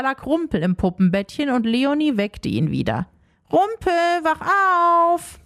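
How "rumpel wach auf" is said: slow, drawn-out wake-up call; calling to wake him. Acted. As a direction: call him awake, stretching the words. Leonie wakes the ghost.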